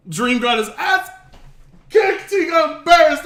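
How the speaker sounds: loud gleeful gloating. Real, not acted. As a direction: crow over it gleefully. Gleeful about Dream's beating.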